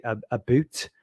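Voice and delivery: lightly done Canadian "a boot"; a hint of Scottish-sounding Canadian on "boot"; Canadian accent. Shown as a demonstration. Quoting how they say about, light Canadian accent. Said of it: "which just sounds really Scottish to me".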